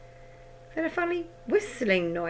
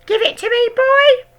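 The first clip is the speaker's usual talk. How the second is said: very high, loud hag shout; 16 dB louder than usual. A narrator's reading. Grandma shouting at George, very high and loud. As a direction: bark at the boy. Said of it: "yelled Grandma".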